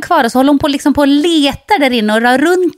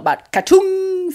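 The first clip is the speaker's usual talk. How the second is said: higher, slow comic boing; cartoon swelling sound; 9 semitones higher, slower than usual. Heel swelling up like a golf ball, comic sound effect. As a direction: pop it out like a cartoon noise.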